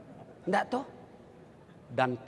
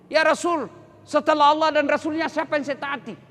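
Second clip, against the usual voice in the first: questioner to the Prophet; 9 semitones higher, 6 dB louder than usual. Acted. Hadith dialogue, questioner voiced.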